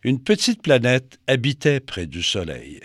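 plain reading voice

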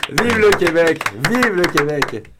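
mock statesman's proclamation, pitched high; Charles de Gaulle. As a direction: proclaim it like a hammed-up speech impression. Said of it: "Charles de Gaulle".

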